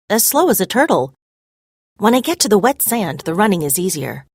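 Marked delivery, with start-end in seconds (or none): none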